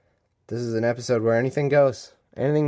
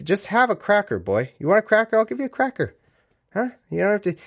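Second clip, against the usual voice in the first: high coaxing voice to the cat; 9 semitones higher than usual. Pretend. Talking to the cat.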